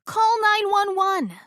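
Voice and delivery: high, loud, slow call; yelled. Acted. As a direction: bellow it so they hear. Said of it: "Bernie yelled".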